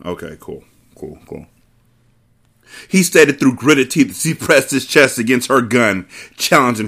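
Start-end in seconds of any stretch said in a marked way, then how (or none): 0.0-1.4 resigned mutter. Real, not acted. Fed up after rant.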